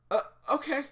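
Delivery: high, loud startled agreement; flustered. Acted. Shy girl told to get going, flustered. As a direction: blurt it out nervously, high.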